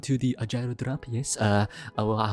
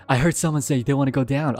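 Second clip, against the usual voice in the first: ordinary talk, nothing marked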